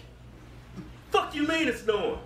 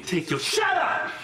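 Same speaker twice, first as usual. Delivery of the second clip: shouting; 6 semitones higher, slower than usual. Real, not acted. Shouts at the other.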